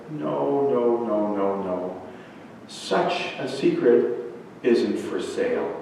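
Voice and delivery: soft, slow string of falling noes; gentle refusal. Acted. Old woman refusing to sell Ryan the secret, soft and slow. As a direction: turn him down quietly and slowly.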